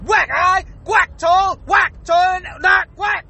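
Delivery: each word chopped apart by a whack; stuttering word-by-word scolding. Acted. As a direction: punctuate every single word with WHACK. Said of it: "I sound like I'm stuttering".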